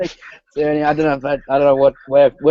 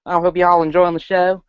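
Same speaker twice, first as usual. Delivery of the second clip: mock hillbilly accent; 7 semitones higher than usual. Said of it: "let's see if I can do it".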